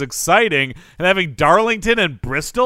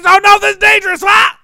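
panicked crash; 14 semitones higher, 8 dB louder than usual. Pretend. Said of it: "Oh, hey, Taylor".

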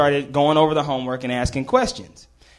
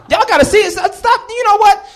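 very high, loud plea of innocence; shouted protest; 8 dB louder than usual. Real, not acted. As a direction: yell your defence over the crowd. Shouts his defence over audience reaction.

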